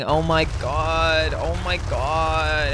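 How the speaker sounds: slow sigh of disbelief; exasperated. Real, not acted. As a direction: drag it out like you're done. Exasperated at conspiracy claim.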